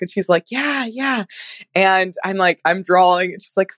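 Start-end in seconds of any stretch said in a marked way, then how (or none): 0.5-1.2 high, soft little imitation; furtive retold reply. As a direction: answer softly, as if talk is forbidden. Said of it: "we're not supposed to really talk".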